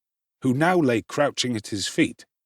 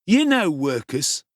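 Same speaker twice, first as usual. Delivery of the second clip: bully Noah; 6 semitones higher, 4 dB louder than usual. Acted. Noah goes on taunting.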